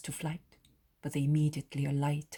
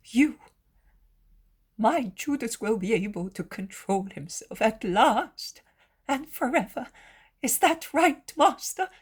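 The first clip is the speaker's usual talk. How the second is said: high, pleading hopefulness; fervent hope; 6 semitones higher, 6 dB louder than usual. A narrator's reading. Mother asks Jesus to confirm her hope, pleading. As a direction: rise to a high, anxious question.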